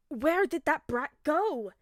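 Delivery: loud, slow rough yell from a stranger. A narrator's reading. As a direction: bellow it down the alley. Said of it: "a voice yelled in the alley".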